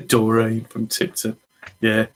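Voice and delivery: ordinary delivery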